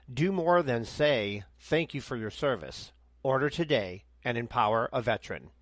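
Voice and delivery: measured, earnest ad read, slowing for the code; sponsor ad read. Acted. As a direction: read the promo like a radio spot. Host reads sponsor ad.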